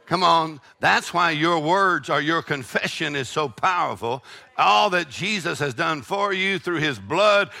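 ordinary talk, nothing marked

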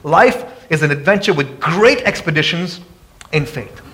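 ordinary talk, nothing marked

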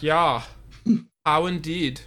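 lightly hammed-up German student; German accent. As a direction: play Reinhold with a mock German accent. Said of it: "my German accent is not as good as I think it is".

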